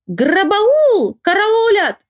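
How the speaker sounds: high, drawn-out cries of alarm; crazed muddled cries. A narrator's reading. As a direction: call out high as if slightly unhinged. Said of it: "уже слегка помешалась и кричит какие-то странные слова".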